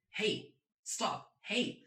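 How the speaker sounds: "Hey" high, with a sharp fall; emergency hey. Shown as a demonstration. Teacher has learners try the emergency "Hey", high and falling. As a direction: demonstrate a high warning "Hey, stop". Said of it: "this hey is not a normal hey".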